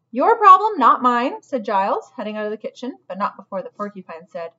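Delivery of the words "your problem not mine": loud, high, dismissive brush-off; dismissive lizard. Acted. Desk lizard leaving the kitchen, loud and dismissive. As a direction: toss it over your shoulder, not your problem.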